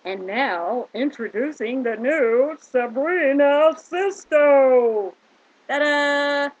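loud, drawn-out grand introduction; show announcer. Pretend. Introducing the new Sabrina Sisto, loud showy announcer. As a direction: announce the star with a big ta-da.

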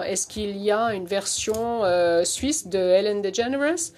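American accent in French; French spoken with an American accent; foreign accent in french. Real, not acted. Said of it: "mon français est vraiment, euh, rudimentaire".

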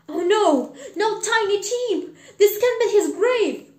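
loud, high cries of dismay; distraught outcry. Acted. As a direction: wail in protest at a terrible discovery. Scrooge finds Tiny Tim's grave.

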